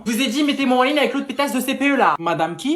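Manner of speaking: fast, high, aggressive phone tirade; worked-up, insulting demand; loud angry rant. Acted. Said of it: "vous allez baisser d'un ton".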